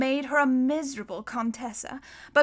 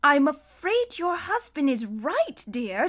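slow, higher-pitched regret; sad; 4 semitones higher, slower than usual. A narrator's reading. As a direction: break the bad news gently and sorrowfully. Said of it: "Justice Strauss said sadly".

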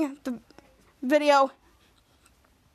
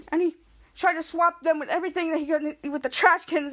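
ordinary delivery, nothing marked

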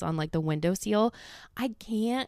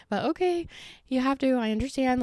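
much higher whiny okay; reluctant acceptance; 6 semitones higher than usual. Retold or imitated. To Alvaro leaving for work, a high reluctant okay. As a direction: give in with a pleading whine. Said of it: "I'm just like".

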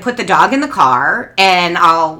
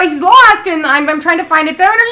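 high, eager explanation; earnest explaining; 9 semitones higher, 5 dB louder than usual. Retold or imitated. Mother telling the owner she's finding the owner, high and eager. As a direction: sincerely explain the lost dog. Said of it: "I go".

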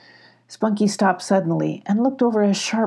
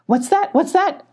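much higher, alarmed goat cry; startled goat; 12 semitones higher, 4 dB louder than usual. A narrator's reading. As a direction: jump out of your skin. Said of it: "clattered Billy, startled".

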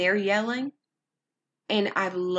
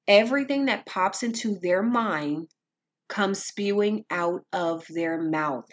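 usual speaking voice

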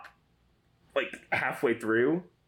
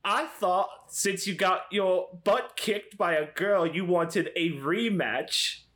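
higher, drawn-out taunt in a put-on voice; Draco; 5 semitones higher, slower than usual. Disguised Cody taunts the boy about a rematch, Draco-like voice. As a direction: goad him in a badly faked snobbish schoolboy voice. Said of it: "it's just turning into Draco now".

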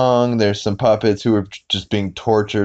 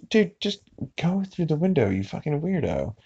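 much softer, muffled aside, starting high; imagined exasperated wife; 7 semitones higher, 7 dB quieter than usual. Retold or imitated. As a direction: grumble it under the breath as the fed-up wife. Voicing wife muttering indoors.

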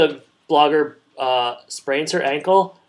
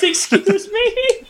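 very high, slow, prim little pig; a dainty pig asking politely, drawn out; much slower than usual. Pretend. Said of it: "He says".

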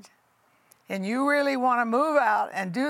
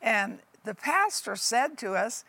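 ordinary delivery

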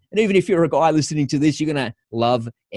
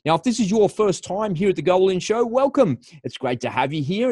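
podcast host intro; 6 semitones higher than usual. Acted.

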